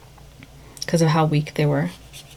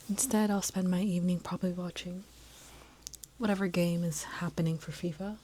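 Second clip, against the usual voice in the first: whispering; 12 dB quieter than usual. Real, not acted. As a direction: whisper it, don't wake him. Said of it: "why I'm whispering".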